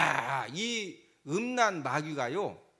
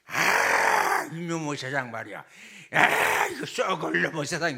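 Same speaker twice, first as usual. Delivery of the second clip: loud opening groan, mock grumble; lightly acted drinker's complaint; grumbling customer; 6 semitones lower, 6 dB louder than usual. Male customer complaining to a bar hostess, mock grumbling.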